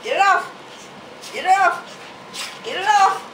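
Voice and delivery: high, building loud cheer; excited urging. Real, not acted. Owner urging her dog to shake off the towel, rising loud cheer.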